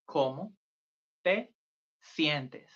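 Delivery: syllable by syllable for beginners; word by word. Shown as a demonstration. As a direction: break ¿Cómo te sientes? apart with pauses.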